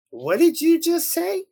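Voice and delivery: high-pitched, disbelieving demand; incredulous question. Retold or imitated. Daughter asking mother to repeat it, high and incredulous.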